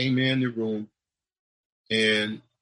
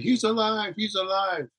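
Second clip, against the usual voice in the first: much higher excited cry; retold screaming; 9 semitones higher than usual. Doctors shouting to the room that the patient revived, high excited cry. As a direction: announce the miracle in a rush of joy. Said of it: "they started screaming".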